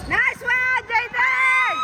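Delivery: high-pitched celebratory shout; cheering. Real, not acted. Parent praising a player's good play, cheering shout.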